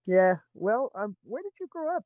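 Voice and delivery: ordinary talk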